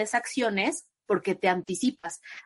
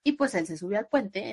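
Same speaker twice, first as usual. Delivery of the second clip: ordinary delivery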